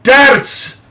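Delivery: Darts! blurted high and loud; excited shout. Real, not acted. Host cuts in, thrilled about darts on TV, excited shout. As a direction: burst out with Darts! in delight.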